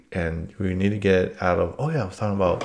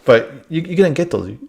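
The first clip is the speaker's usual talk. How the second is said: usual speaking voice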